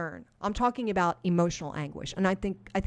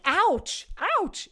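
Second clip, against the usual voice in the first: higher, a hammed-up little yelp of hurt; a pretend sting of pain; mock pained ouch; 11 semitones higher, 4 dB louder than usual. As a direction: wince out loud, playfully. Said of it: "that moment where it's like".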